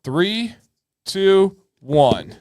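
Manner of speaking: rising, building countdown. Real, not acted. Host counting down the reveal, building.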